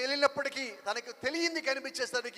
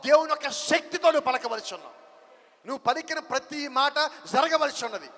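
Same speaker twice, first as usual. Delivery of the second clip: fiery preaching; 2 semitones higher, 5 dB louder than usual. Acted. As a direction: preach it to the rafters. Preaching at full force.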